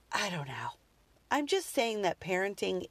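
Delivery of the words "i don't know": whispered, unsure aside. Real, not acted. Mom confessing doubt to listeners, whispered. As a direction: drop to a whisper, half to herself. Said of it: "whispered aside".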